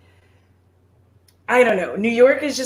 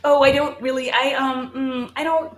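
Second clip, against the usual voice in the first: high flustered stammer; awkward stammering; 7 semitones higher than usual. Retold or imitated. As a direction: fumble to dodge the handshake. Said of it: "I'm usually sitting there just like".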